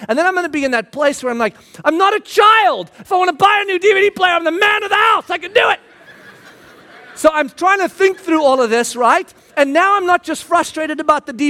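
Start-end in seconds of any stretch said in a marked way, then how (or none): 1.8-5.8 defiant shout. Pretend. Hypothetical defiant outburst at wife.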